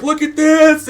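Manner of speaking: put-on a kid squealing to his parents to look; squeaky excited. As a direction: show it off, voice cracking high. Kid shows proof to parents.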